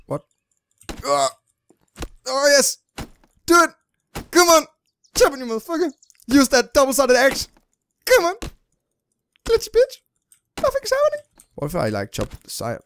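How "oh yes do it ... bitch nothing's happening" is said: very high, worked-up yelling; frantic high squealing. Real, not acted. Player urges on the axe chopping a tree, frantic high squeal. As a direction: urge it on in a squeal, climbing higher.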